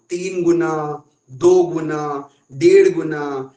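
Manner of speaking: teacher spelling out the multiples; stressed examples. Shown as a demonstration. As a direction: punch out दो and डेढ़ one by one. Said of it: "ये जो हम लोग बता रहे हैं ना".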